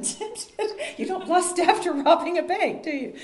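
talking through laughter, pitch rising high; laughing. Real, not acted. Joking with the group about temptation.

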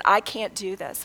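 normal talking voice

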